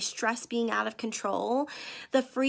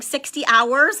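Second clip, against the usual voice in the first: mock disbelief, much louder; incredulous; 3 semitones higher, 8 dB louder, slower than usual. Host voicing viewers' shock at the long fast, incredulous. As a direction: play the shocked viewer hearing it first time. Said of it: "I know what you're thinking".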